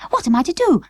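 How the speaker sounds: high, desperate doll voice; distressed doll cry. Acted. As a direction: cry it out in a tiny panic. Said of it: "cried Birdie".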